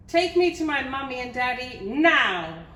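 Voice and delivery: loud, high princess order with a long final NOW; bossy demand. A narrator's reading. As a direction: shout it, landing hard on NOW. Princess repeats her demand, wrongly.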